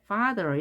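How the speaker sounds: "father" with a strong, held FA; "father", first syllable strong, second light; stress on first syllable. Shown as a demonstration. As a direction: lean on FA in "father". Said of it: "앞에 A의 강세가 있기 때문에".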